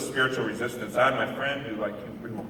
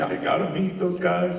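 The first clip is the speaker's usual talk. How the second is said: mildly tearful, higher-pitched plea; retold tearful plea; 7 semitones higher than usual. Grieving mother asking security to meet them, mock tearful. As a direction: imitate a woman pleading, pitched up. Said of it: "she's crying".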